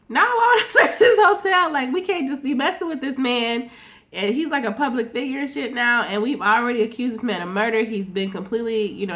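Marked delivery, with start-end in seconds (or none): 0.1-3.7 much higher, louder laughing mock voice, lightly voiced; a hammed-up dismissive cop, laughing; mock Saxe retort.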